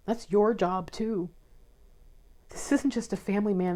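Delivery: soft, teary voice holding back tears; fighting back tears while reading; tearful and choked up. Real, not acted. Tarot reader to viewers, moved to real tears, tearful. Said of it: "I'm not really one for crying on the internet".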